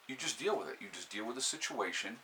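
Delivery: usual speaking voice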